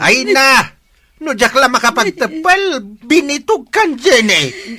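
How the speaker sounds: father screaming at Jenny in fury; furious shout. Acted. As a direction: explode at her at full volume. Father explodes at daughter.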